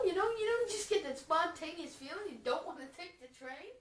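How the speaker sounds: ordinary delivery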